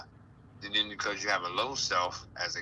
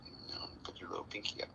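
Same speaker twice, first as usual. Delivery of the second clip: whisper; 3 semitones lower, 14 dB quieter, much faster than usual. Shown as a demonstration. Dainty teacup pinky, whispered.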